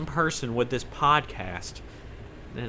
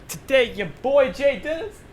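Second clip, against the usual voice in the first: hype announcer; 13 semitones higher, 3 dB louder than usual. Pretend. Hyping himself up, announcer style.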